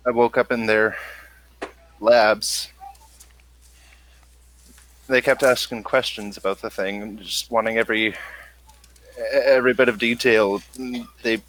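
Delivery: ordinary delivery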